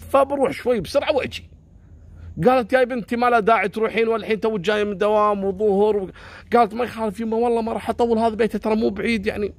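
high, quick coaxing voice; a daughter begging her mum, voiced high and pleading. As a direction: coax her mother to let her go. Daughter and mother argue, voiced high.

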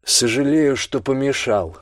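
cold metallic voice, slow at end; booming metallic robot. Acted. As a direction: grind it out like metal. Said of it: "раздался металлический голос".

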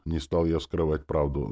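usual speaking voice

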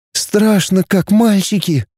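sighing. A narrator's reading. Girl hears boys plan to shoot, sighing anxiously. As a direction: sigh it out, a touch afraid. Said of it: "вздохнула Наташа".

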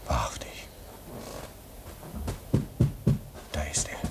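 hushed, breathless whisper as footsteps near. Acted. Man whispers to his comrade as someone approaches, hushed whisper. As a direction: breathe it out, barely voiced. Hearing Gregor approach outside.

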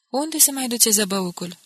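puzzled. A narrator's reading. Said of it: "întrebă nedumerit".

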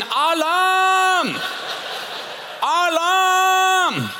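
hammed-up alarm call; firefighter yelling alarm. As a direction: shout it like a panicked fireman. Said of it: "Feuerwehrmann rausschreien".

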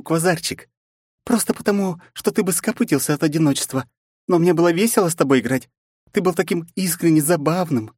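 high mocking princess voice. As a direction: sneer it out as a cruel princess. Princess voiced by male narrator.

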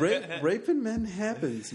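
laughing. Real, not acted. Laughing disbelief.

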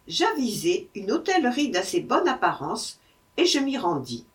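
ordinary delivery

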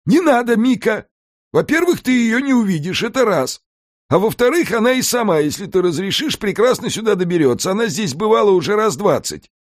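a scheming house spirit pitching the plan; Alfred the house spirit. A narrator's reading. Alfred to Mika, laying out the island scheme, in a high lively voice.

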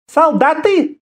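high female exclamation; incredulous woman leader. A narrator's reading. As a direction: throw it back in a high, imperious voice. Woman leader reacts with scornful surprise. (высокий удивлённый женский возглас)

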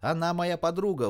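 put-on high, loud girl's protest; frantic yell. A narrator's reading. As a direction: cry out in defence of a friend. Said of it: "истошный вопль Катерины".

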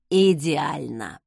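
low, slow mock delight; insincere, drawn-out praise; fake admiration. A narrator's reading. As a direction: pretend to be thrilled, drawn out and low. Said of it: "фальшиво восхитился он".

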